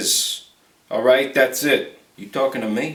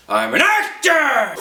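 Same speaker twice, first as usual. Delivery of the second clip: much higher, slow shout; shouted outburst; 10 semitones higher, slower than usual. Retold or imitated. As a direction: shout it indignantly.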